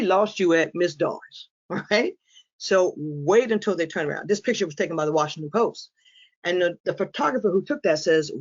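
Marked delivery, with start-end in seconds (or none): none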